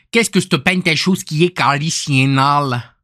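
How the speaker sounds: broad mock Québécois accent; Canadian French accent. Shown as a demonstration. Streamer demonstrating accents to viewers, Canadian French accent. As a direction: rattle it off in caricatured Québécois. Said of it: "Le canadien, c'est".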